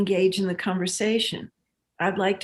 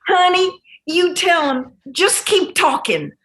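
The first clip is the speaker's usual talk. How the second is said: put-on strong Southern accent; 8 semitones higher, 5 dB louder than usual. Old lady from Texas advising a workshop teacher, strong Southern accent. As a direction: do a folksy Texas Southern accent, loud and emphatic. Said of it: "she's got a really strong Southern accent".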